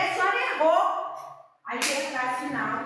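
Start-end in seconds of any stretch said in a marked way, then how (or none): 0.0-0.8 louder, higher firm "A senhora errou."; firm accusation. Retold or imitated. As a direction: say it back at her, firmly. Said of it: "Eu falei". (acusação firme, mais alta)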